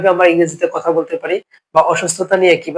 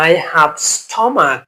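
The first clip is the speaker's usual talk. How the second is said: slow model phrase; much slower than usual. Shown as a demonstration. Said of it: "কি বলেছি?".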